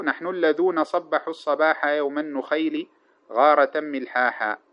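slow, measured poetry recitation; chanted classical verse, slow and deliberate; reciting verse. Shown as a demonstration. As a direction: draw out each line of the old poem. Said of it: "ومنه قوله".

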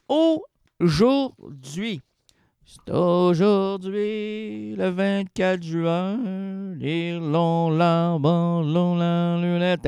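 drawn-out, very slow solemn announcement; slow announcer intro. Acted. Comic host opening his show, very slow and solemn. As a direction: stretch every word like a grand opening.

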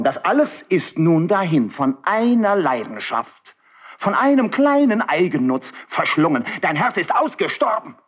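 rising high, pained accusation; impassioned reproach. Acted. Marquis reproaches Karl for his dead heart, voice climbing high. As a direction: lash him with grief and scorn.

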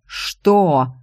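understated outraged yell. Acted. Blonde yells in the secretary's face, narrated mildly. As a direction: burst out in disbelief. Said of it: "проорала блондинка".

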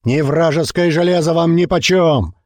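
rousing orator, high and slow; booming speech. A narrator's reading. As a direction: thunder it out to the crowd. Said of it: "гремел голос Черепа".

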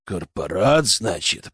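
deep, menacing growl; low growl. A narrator's reading. Interrogator takes in the poacher's confession, low growl. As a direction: growl it low, holding anger back. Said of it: "прорычал я".

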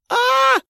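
short, very high startled yelp; startled shriek. A narrator's reading. Dasha caught undressed by the lad, startled shriek. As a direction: let out a sudden high, loud cry. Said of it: "вскрикнула Даша".

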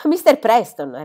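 sbotta incredulo, più forte e più acuto. A narrator's reading. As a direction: ripetilo come uno schiaffo inatteso. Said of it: "ripete lui". (padre che ripete il nome sbalordito, forte)

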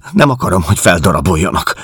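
high, loud, hurried plea; fearful protest. Acted. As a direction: refuse it in agitated dread. Helen dreads being cut up. (riadtan, magas hangon tiltakozva)

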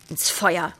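usual speaking voice

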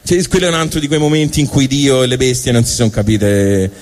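usual speaking voice

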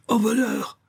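very high cry of alarm; panicked squeal; cried out. A narrator's reading. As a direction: shriek it as if being robbed. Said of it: "cria Isaac".

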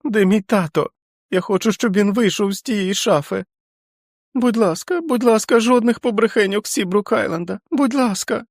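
put-on a five-year-old crying for his father; pleading child. A narrator's reading. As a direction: beg like a scared little boy. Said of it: "голосом п'ятирічної дитини".

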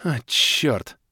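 short snarled curse; growled curse. A narrator's reading. Baroness reading her messages, growling. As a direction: growl it in annoyance. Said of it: "рыкнула баронесса".

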